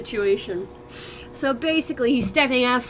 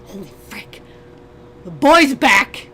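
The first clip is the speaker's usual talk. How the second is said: ordinary talk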